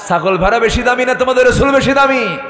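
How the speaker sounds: high, shouted challenge; fiery oration. Acted. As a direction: throw the question out at full force. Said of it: "তেজোদ্দীপ্ত একটা ভাষণ দিলেন". (তেজোদ্দীপ্ত চড়া গলায় challenge)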